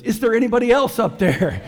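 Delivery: high, loud plea with a laugh in it, leaning on "else"; hopeful call upward. Acted. Hiker rejecting God's advice, calling up high and loud, leaning on "else". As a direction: ask hopefully for a second opinion, stressing "else". Punchline, Ole asks again.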